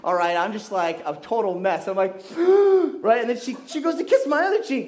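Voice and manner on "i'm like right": a sharp high gasp of panic; shocked gasp. Retold or imitated. Teen boy kissed on the lips by surprise, gasping.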